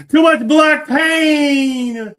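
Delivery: high, loud and slow, shouting it out; emphatic shout. Real, not acted. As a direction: bellow the point at the other guest.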